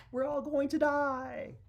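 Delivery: high, loud panicked wail; hammed-up doomed scream; freaking out. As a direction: cry out in mock panic, drawn out. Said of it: "they are freaking out".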